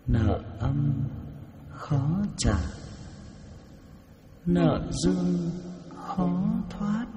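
slow, rising echoing demon voice; new demon voice. Shown as a demonstration. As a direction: let it creep up like a spirit. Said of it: "Còn cái mới thì nó sẽ thêm cả cái này nữa".

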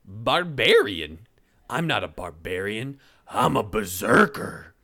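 indignant correction. Acted. Braun corrects the insult.

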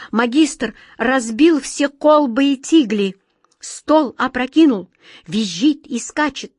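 loud, high, emphatic courtier; agitated report. A narrator's reading. Courtier telling the king the master smashed everything, loud and agitated. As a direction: pile up each disaster with alarm.

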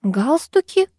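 high, rising echo; lightly baffled schoolgirl question; puzzled query. A narrator's reading. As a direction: ask back in bewilderment. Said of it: "переспросила Вика".

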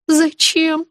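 high, small, horrified question; puzzled dread. A narrator's reading. Patient dreading the drive, puzzled and afraid. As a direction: ask in understated dread. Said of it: "Не поняла я, испытывая ужас".

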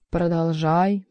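ordinary delivery, nothing marked

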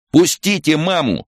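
put-on very high boy's shout; piping, urgent demand; desperate child's yell. A narrator's reading. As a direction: cry out to free mother. Boy yelling through broken window.

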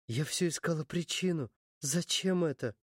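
plain reading voice

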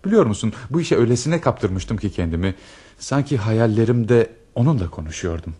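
usual speaking voice

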